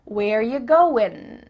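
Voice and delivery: slow "goin", held on the n; slow "where ya goin"; dropped G. Shown as a demonstration. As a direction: stretch "going" to end in n. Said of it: "It ends with an N".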